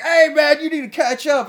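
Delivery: friends calling out. Retold or imitated. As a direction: yell it like drunk friends calling him over. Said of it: "And they're all like".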